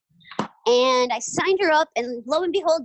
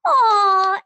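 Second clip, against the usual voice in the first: loud, high crowd aww; imitating the audience melting at the child's line; 9 semitones higher, 8 dB louder than usual. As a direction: swoon like a charmed audience. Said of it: "And the crowd goes".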